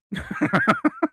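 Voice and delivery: high-pitched burst of laughter; high giggling laugh. Real, not acted. Laughs amid playful banter.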